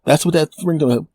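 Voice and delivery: ordinary talk, nothing marked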